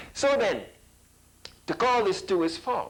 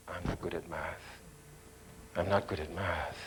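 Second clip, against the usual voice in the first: very low, hushed, defeated admission; self-blaming pupil; 11 semitones lower, 11 dB quieter than usual. Acted. Pupil blaming themselves for failing at math, low and dejected. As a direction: drop right down, quietly blaming yourself. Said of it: "Don't you blame yourself?".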